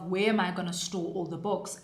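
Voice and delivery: normal talking voice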